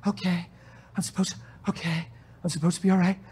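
hushed, anxious self-talk trying to stay okay; mock nervous believer talking himself down; a hint of strained calm; forced composure. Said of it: "you're gonna go".

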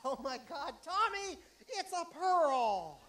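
loud, high, amazed cry over a find; mock excitement. Comic showing a friend his sandy pearl, excited. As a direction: gasp it out like you struck treasure.

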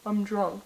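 put-on "drunk" said with an O vowel, "dronk"; soft "drunk" turned into "dronk". Shown as a demonstration. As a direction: pronounce "drunk" as D-R-O-N-K. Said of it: "D R O N K".

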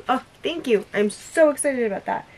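ordinary delivery

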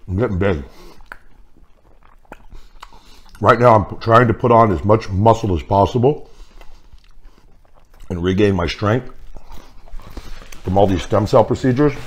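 talking between bites; slightly muffled chewing speech; mouth full, chewing. Real, not acted. Seen 2: Holds small sandwich, chews between words, bites again at 9.3s.

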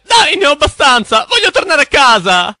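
high whining plea; high, pleading whine to go home; whiny complaint. Acted. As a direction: whine like a fed-up kid. Fed up, wants to go home.

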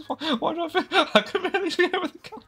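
high-pitched words through laughter; can't stop laughing at how short he is. Real, not acted. Laughing at shrunken character.

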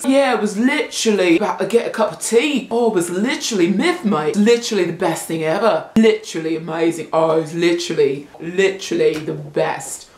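put-on British accent, sing-song; mock British accent. Shown as a demonstration. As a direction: imitate Brits chatting over tea. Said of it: "and the Brits say literally".

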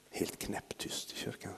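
slow, breathy stage whisper. Pretend. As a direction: whisper it like in a silent church. Describing silent church, whispered. (viskande, långsamt)

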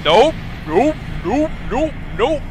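rising scared nope, over and over; frightened repeated refusals; panicked nopes. Real, not acted. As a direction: back away fast, nope nope nope.

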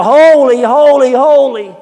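high, loud angelic holy; crying out. Acted. Angels around the throne crying out, high and loud. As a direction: shout each holy up to heaven. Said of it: "angels flying around crying out".

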